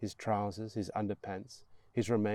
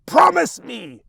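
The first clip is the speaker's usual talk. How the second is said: loud, high shrieked demand; 17 dB louder than usual. Acted. Mother gripping her son's wrist, shrieking. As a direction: scream it like a madwoman. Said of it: "she shrieks".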